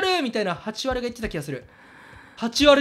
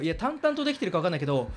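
ordinary talk, nothing marked

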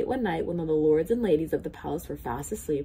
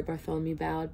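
usual speaking voice

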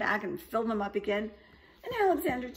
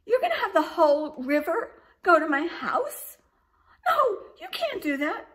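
put-on much higher, louder shocked young dragon; panicked baby dragon; 11 semitones higher, 11 dB louder than usual. A narrator's reading. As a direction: cry out in alarm as the dragon. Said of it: "said the baby dragon".

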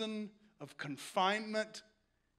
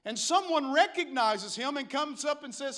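usual speaking voice

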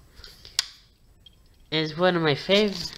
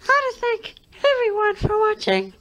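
very high, squeaky and loud; 5 dB louder than usual. Real, not acted. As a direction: squeak it out in a tiny voice. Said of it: "I'm sorry about my squeaky voice".

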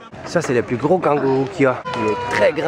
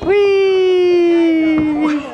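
triumphant cheer; 12 semitones higher, 5 dB louder than usual. Real, not acted. Child finishes the slide race.

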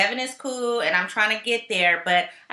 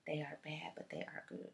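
hushed aside; 6 semitones lower, 22 dB quieter than usual. Acted. Confiding aside.

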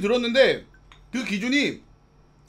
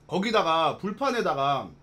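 eating between words; talking while chewing; 5 semitones lower, 4 dB quieter than usual. Real, not acted. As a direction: mouth partly full of food. Seen 0: eats fried rice spoonfuls while speaking between bites.

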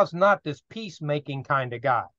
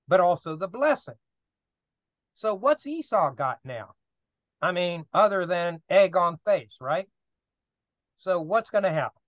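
ordinary delivery

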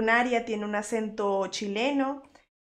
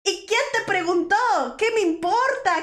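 high, exasperated comic rant; playfully indignant, pitched way up; mock outrage; 8 semitones higher than usual. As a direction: pretend to be furious at a tactless viewer. Mock tirade at viewer naming rival stream.

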